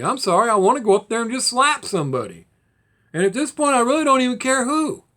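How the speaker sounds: fed-up vlogger venting, voice rising angrily; real anger. Real, not acted. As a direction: let the anger spill over, voice climbing. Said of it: "I'm just so angry over it".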